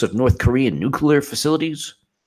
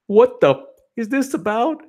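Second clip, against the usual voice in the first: much higher mocking whine; 13 semitones higher than usual. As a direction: whine it like a distraught fan. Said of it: "then she goes".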